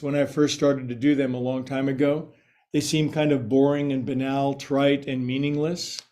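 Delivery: normal talking voice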